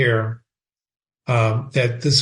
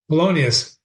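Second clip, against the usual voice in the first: ordinary delivery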